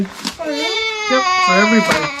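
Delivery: high, loud wailing cry. Real, not acted. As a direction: wail it out through tears. Child crying, projects ended.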